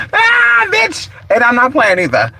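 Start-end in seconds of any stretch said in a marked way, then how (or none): 0.1-1.1 high gleeful squeal; high squealing "ah, bitch"; excited. Real, not acted. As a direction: yelp it with delight. Laughing exclamation.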